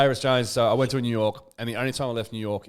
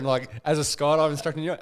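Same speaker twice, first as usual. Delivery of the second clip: usual speaking voice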